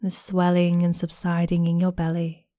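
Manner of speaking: normal talking voice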